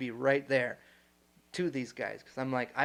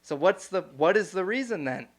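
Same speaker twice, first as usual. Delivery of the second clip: raised, louder mock anger at the speaker; heated retold question; 2 semitones higher, 5 dB louder than usual. Retelling how he challenged the speaker, raised and louder. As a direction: press him hard on the numbers, voice up and louder.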